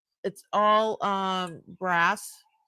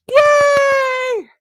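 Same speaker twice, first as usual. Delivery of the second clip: much higher and louder joyful yell; cheering shout; 11 dB louder than usual. Real, not acted. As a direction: cheer at the top of your voice. Cheering after the bell.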